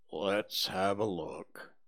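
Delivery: low, slow, whispered and mournful; gloomy donkey Eeyore. Acted. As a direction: sigh it out like a sad old donkey.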